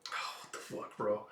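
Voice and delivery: under-the-breath whisper; shocked whisper; whispered aside. Real, not acted. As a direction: hush it out in disbelief. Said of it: "own aside, whispered".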